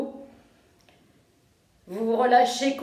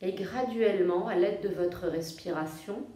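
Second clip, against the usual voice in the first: soft, low-key yoga nidra voice with long pauses; guided meditation; 5 semitones lower, 6 dB quieter than usual. Real, not acted. Yoga teacher guiding listeners to feel their breathing, calm meditation voice. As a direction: guide them gently into rest, phrase by phrase.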